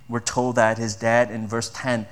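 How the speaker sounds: usual speaking voice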